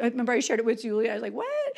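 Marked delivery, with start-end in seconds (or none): 1.3-1.7 high-pitched mock "what?"; retold surprise. Telling Julia's shared moment over the text, retold surprise. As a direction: squeak it out as a startled reaction.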